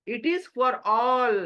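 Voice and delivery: very slow, every word weighted; slow emphasis. Real, not acted. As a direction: drive home it is for all. Emphatic English summary. (बहुत धीरे, हर शब्द पर ज़ोर)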